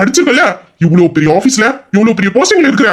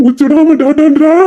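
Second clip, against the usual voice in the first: 1 mocking mimicry; 4 semitones higher, much slower than usual. Husband mimics wife's repeated da.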